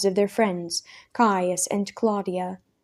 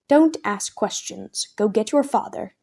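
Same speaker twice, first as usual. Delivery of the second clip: ordinary delivery, nothing marked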